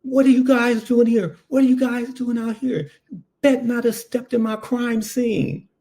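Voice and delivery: furious cop yelling at the paramedics; angry detective yelling. Retold or imitated. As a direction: bark at the intruders from the crime scene. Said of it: "Mark Furman is yelling".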